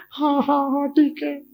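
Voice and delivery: put-on high, little boy's yes; child agreeing. Boy giving in to his mother, high child voice.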